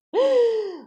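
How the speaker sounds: a loud, high gasp of alarm; sudden shocked intake of breath; sharp gasp. Acted. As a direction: gasp as you realise it's burning. Kitchen boy smells burning roast.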